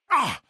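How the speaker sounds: short, high yelp of pain; pain cry. Acted. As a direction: yelp at a sudden wound.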